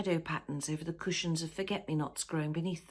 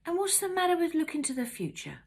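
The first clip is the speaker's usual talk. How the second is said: put-on higher, lighter young voice; young lad voice; 12 semitones higher than usual. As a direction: voice the teenage son. Jessie voiced, high far.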